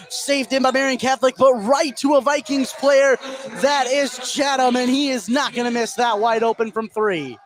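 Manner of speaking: excited call. Real, not acted. Live call of a wide-open three.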